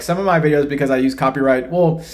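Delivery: ordinary talk, nothing marked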